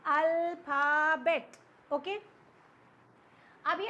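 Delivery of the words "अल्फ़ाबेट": अल्फ़ाबेट loud and slow as al, pha, bet; broken into syllables. Shown as a demonstration. Teacher showing how the word is pronounced, loudly syllable by syllable. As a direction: sound out अल्फ़ाबेट with a gap after each of its three parts. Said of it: "कैसे pronounce कर रहे हो?".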